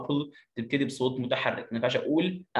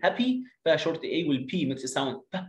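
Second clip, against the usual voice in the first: ordinary delivery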